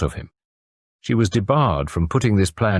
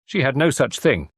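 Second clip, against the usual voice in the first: usual speaking voice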